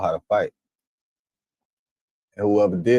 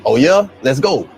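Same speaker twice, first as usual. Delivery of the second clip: hyped-up challenge; 4 semitones higher, 7 dB louder than usual. Retold or imitated. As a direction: rise to the fight, laughing. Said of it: "You know my response is".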